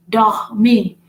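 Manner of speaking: "Dormir" with guttural French R. Shown as a demonstration. Teacher showing a word with two R's, "Dormir" with guttural R. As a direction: bring out the throaty R in "Dormir". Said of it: "Dormir have two R".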